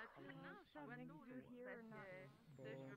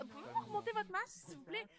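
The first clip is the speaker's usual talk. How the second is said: higher, fast, mocking the yelling customer; 10 semitones higher than usual. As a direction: parody her demand, squeaky and rushed. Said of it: "tu vas crier après un enfant".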